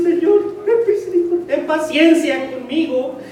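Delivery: high, loud begging voice; pleading servant. Acted. Servant begging his master for patience, high pleading. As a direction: plead like a desperate debtor. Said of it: "le suplicaba diciendo".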